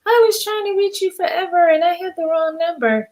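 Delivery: high-pitched imitation of a griping caller; mock complaining caller. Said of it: "People be hitting me up like".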